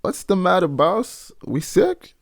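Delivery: put-on high-pitched old Southern accent; imitated slave's accent, anxious questioning of the boss; put-on house slave accent. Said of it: "sorry for the accent, I just decided to go for it".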